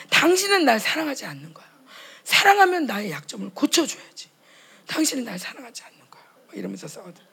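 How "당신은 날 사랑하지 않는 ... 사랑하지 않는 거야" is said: loud, high hurt accusation; a wife's theatrical reproach; mock accusing quarrel. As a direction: throw the accusation back at your husband. Acted marital quarrel, accusing.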